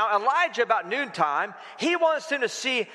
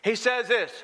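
ordinary delivery

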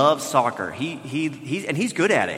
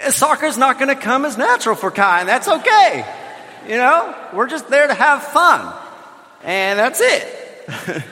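higher, louder, laughing through the words; flustered laughing; 8 semitones higher, 4 dB louder than usual. Real, not acted. Father to the church, laughing off his son's first practice. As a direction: brush it off with an embarrassed laugh. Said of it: "I'm not really sure what just happened here".

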